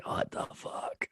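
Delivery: slow, breathless whisper; whispered disbelief. Retold or imitated. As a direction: whisper it in shock. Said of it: "I was like".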